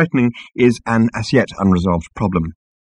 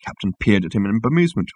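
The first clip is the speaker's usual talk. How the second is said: plain reading voice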